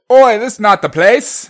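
loud, high, slow Cockney protest; mock Cockney passenger. Passenger objecting to cab driver, shouted mock Cockney. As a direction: holler it in a hammed-up Cockney. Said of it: "the passenger reportedly said".

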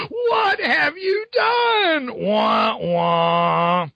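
high, very slow mock wail. Ghost Spider-Man mocked, a hammed-up wail. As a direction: whine it like a crybaby. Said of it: "Ghost Spider-Man says".

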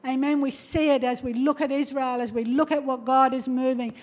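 ordinary talk

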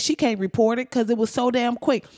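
ordinary delivery

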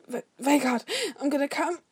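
high, stammered, breathless warning; urgent, rising gasp; breathless urgency. A narrator's reading. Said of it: "he breathed".

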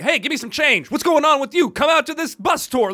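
mock street yelling; street hawker yelling. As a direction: holler at a passerby for change. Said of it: "people yelling at you all the time".

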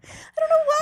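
high giggling through the words; laughing. Real, not acted. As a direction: laugh helplessly at yourself. Giggling at own stoned confession.

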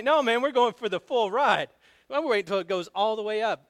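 loud, high, eager refusal; telling a scared buddy no, loud and eager; excited insistence. Retold or imitated. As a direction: push back loudly, thrilled for the ride. Refusing buddy's plea on ride.

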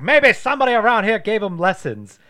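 high, loud, exasperated doctor; Loomis impression. Quoting the exasperated doctor to his co-host, loud. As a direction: blurt it out in outrage. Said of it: "He's like".

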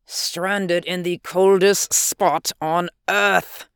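slow groaning lament. Acted. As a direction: groan it out, stranded and hopeless. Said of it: "groaned the scientist".

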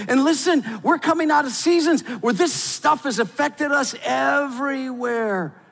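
high, loud preaching climax; full-force preaching. Acted. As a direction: preach it out with fire.

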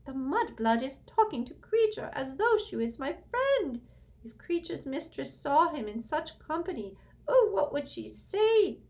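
high, loud, bitter elf grumble; furious house-elf muttering. A narrator's reading. House-elf rages at Hermione being called his friend, high and bitter. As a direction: spit it out like an outraged old elf. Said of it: "muttered faster and more furiously than ever".

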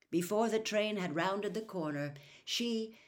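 ordinary delivery

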